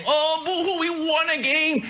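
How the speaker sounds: high mock whine; hammed-up whiny Jets fan; mock whining fan. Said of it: "stop whining, stop moaning".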